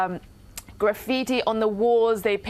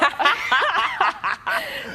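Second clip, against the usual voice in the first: bright laugh; 7 semitones higher than usual. Real, not acted. Laughing at farewell.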